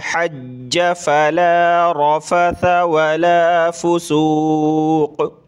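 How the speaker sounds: slow, drawn-out tajweed chant; slow Quranic recitation. Shown as a demonstration. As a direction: recite it melodically, stretching each vowel.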